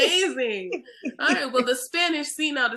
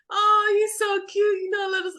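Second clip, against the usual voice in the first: higher, sing-song condescending voice; patronizing cooing; 9 semitones higher, 3 dB louder than usual. Retold or imitated. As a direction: coo at her like she's adorable. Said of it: "you know, like".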